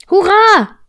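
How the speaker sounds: put-on high, loud child's hurrah; jubilant cheer. A narrator's reading. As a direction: cheer it out at full voice. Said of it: "hast du gerufen". (hoher, lauter Jubelruf)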